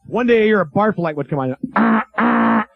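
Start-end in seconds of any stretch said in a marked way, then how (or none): 1.8-2.7 higher buzzer-like alert; janitor's mock emergency call; alarm call. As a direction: sound the alarm, then announce it.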